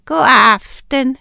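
slow, syrupy greeting; sugary sweet. A narrator's reading. Witch greeting her hosts, sugary sweet. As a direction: sweeten it up falsely. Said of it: "sagde hun sukkersødt".

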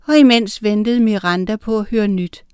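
ordinary delivery, nothing marked

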